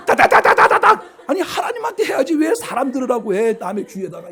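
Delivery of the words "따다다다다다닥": high, loud rattling tongues imitation; mock rapid chatter. Mocking a deacon's tongues in someone's ear, high rattling chatter. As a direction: rattle it off like a machine gun.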